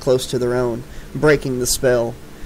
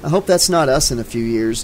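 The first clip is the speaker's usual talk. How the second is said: plain reading voice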